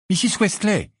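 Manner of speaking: high, loud, strained call; hoarse call. A narrator's reading. Harry calls to Missus Wesley, hoarse call. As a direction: call out her name, voice hoarse with shock. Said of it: "appela Harry d'une voix rauque".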